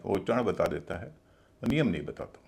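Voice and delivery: normal talking voice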